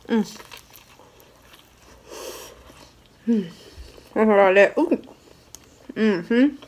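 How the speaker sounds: talking while chewing; muffled by food in mouth; mouth full. Real, not acted. Seen 0: bit egg roll just before; holds it, mouth seems full.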